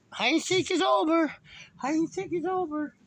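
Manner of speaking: high, long-drawn holler; calling out. Retold or imitated. Owner tells hiding dog game is over, high calling.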